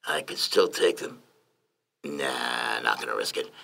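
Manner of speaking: low, soft musing to himself; thinking out loud. A narrator's reading. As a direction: think it through under your breath. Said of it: "Lyndon heard Johnny think out loud".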